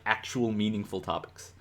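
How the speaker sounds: usual speaking voice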